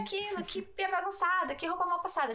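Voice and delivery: high, nagging mom voice; imitating a fussy mother listing chores.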